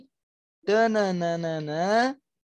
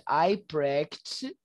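slow, clear teacher model; slow model line; 3 semitones lower, 4 dB quieter, much slower than usual. Shown as a demonstration. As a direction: say it slowly for them to repeat. (frase modelo lenta)

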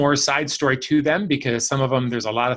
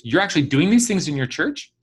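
retold amazement, pitched up and rising; disbelieving quoted question, higher at the end; incredulous question; 4 semitones higher than usual. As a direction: imitate someone astonished, climbing to the question. Astonished manager, acted in retelling.

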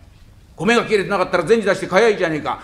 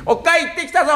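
high call home; high, bright shout to the wife; 12 semitones higher than usual. Acted. Husband calling on return.

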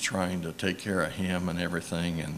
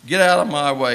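louder, raised mock yell; retold yell; 6 semitones higher, 10 dB louder than usual. Cart man retelling a driver who yelled at him, a louder mock yell. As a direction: bark the order like an angry driver. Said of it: "he yelled out at me".

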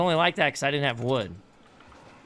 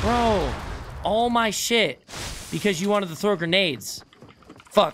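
loud frustrated yelling at teammate; angry yelling; 6 semitones higher, 4 dB louder than usual. Real, not acted. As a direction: yell it out, fed up. Teammate lost his loot.

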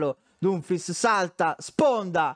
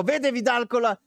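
shouting, pitch climbing with the play; breathless italian goal commentary; 8 semitones higher, 3 dB louder than usual. Real, not acted. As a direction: build to a yell as the chance comes. Live chance, shouting.

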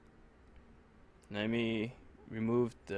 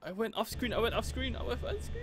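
high, climbing whine of dismay; panicked; 10 semitones higher, 4 dB quieter, faster than usual. Real, not acted. Player realising they went off screen, panicked. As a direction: repeat it higher and higher in panic. Panicked excuse after losing control.

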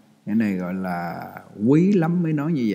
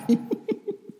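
breathy laugh. Real, not acted. Laughs at the revived nun.

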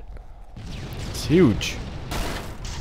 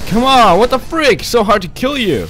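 frustrated yell; 10 semitones higher, 5 dB louder than usual. Real, not acted. Frustrated at a tough enemy.

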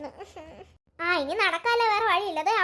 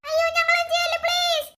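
frightened pleading; 7 semitones higher than usual. Acted. Girls beg the kidnapper.

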